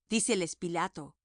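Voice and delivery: ordinary delivery, nothing marked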